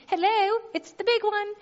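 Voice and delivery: high, pointed hello, come on; exasperated hint. Retold or imitated. Wife prodding her husband at the waterbed store, high and pointed. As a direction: nudge him, it's obviously the big one. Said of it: "I was like".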